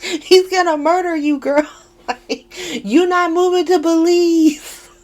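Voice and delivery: high, loud, laughing warning; laughing disbelief. Real, not acted. Viewer warning a soap character through laughter. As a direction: laugh while warning her.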